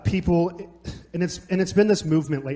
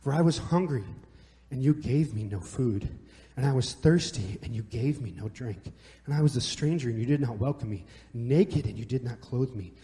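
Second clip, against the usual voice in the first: scripture reading; 4 semitones lower than usual. Acted. Reads scripture aloud.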